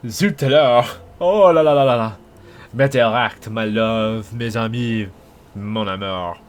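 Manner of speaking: hammy fake French accent; playful over-the-top French flirt; french chef. Mock French flirting with chat.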